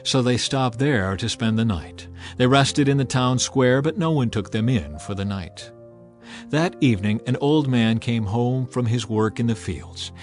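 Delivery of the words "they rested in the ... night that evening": ordinary talk, nothing marked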